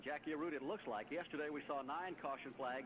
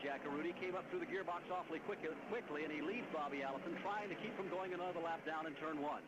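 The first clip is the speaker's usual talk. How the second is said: motorsport commentator; 5 semitones higher than usual. Acted. Live race call, lead change.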